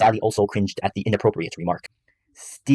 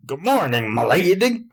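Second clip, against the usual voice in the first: high, hollered Texan greeting; gruff Texan yell; 9 semitones higher, slower than usual. A narrator's reading. Husband greeting his wife, gruff Texan holler. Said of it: "yelled out his morning greeting in his gruff Texan accent".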